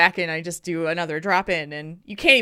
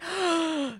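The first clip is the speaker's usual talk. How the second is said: gasp; 8 semitones higher, 5 dB quieter than usual. Retold or imitated. Surprised he is free now.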